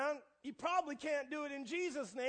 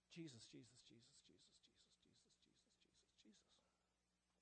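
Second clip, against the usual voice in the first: soft breathy whispered Jesus, Jesus; muttered under her breath; 10 semitones lower, 25 dB quieter than usual. Retold or imitated. Older lady whispering Jesus under her breath at church. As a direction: whisper the name over and over to yourself. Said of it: "under her breath".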